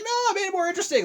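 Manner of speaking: very high, fast mock panic; comic high-pitched imitated protest; mock dismay. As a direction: blurt it up high, hammed-up. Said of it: "It's like".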